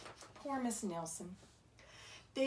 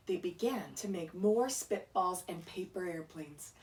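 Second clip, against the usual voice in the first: plain reading voice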